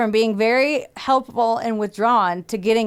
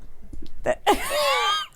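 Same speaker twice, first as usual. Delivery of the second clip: shrieking laugh; 3 dB quieter than usual. Real, not acted. Reacting to co-host saying I'm out.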